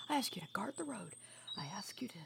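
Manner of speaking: furious hushed whisper; angry whisper. Acted. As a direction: scold her under your breath so the stranger can't hear. Scolding sister, hiding from stranger.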